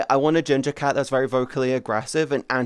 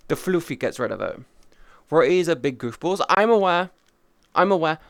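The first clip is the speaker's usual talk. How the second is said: normal talking voice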